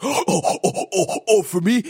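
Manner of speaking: excited. Pretend. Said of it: "He's like".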